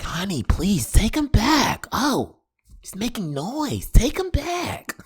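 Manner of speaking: high, slow, pleading salon lady; mock nail lady plea. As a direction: beg her to take him back, mock pleading. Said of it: "she was like".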